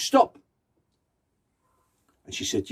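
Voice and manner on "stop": sharp, louder shout of stop; grandma's shout. Acted. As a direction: cut through the arguing with one word. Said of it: "the grandma shouted".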